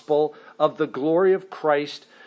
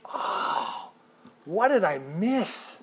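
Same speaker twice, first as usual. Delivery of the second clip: hushed realization; 2 semitones higher, 4 dB quieter, slower than usual. Retold or imitated. Reader discovering Scripture.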